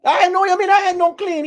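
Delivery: outraged old goat reacting to gossip; shocked goat. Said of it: "Oxtail go".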